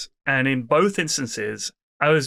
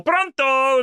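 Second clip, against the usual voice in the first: much higher, louder Italian "Pronto"; Italian phone greeting; 11 semitones higher, 3 dB louder than usual. Retold or imitated. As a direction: sing out "Pronto" like an Italian operator answering. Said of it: "She's like".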